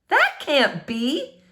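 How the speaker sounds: slow, stunned denial; drawn out in disbelief; slow stunned neighbor. Acted. She repeats herself.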